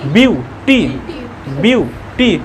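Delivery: ब्यूटी broken into "byu", pause, "tee"; two separate beats in ब्यूटी; split into syllables. Shown as a demonstration. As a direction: sound out ब्यूटी beat by beat. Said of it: "इसको एक ही बार में नहीं बोल पा रहे हैं".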